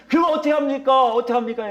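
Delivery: high, pressing question; frustrated churchgoers. Retold or imitated. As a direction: imitate a believer at a loss. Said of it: "답답해 죽겠대요".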